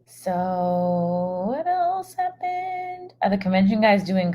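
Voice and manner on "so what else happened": slow drawn-out question. Retold or imitated. Reading a viewer question slowly.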